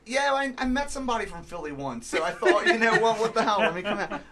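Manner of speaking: put-on an LA guy doing a so-so Philly accent; hammed-up Philly accent with laughs. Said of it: "I'm doing a Philly accent for someone from L A".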